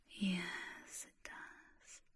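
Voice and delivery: an airy whisper, no voice at all; whispered yes. Acted. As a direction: breathe the answer right into the ear. Affirming own question in whisper.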